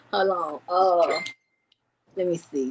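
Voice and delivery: normal talking voice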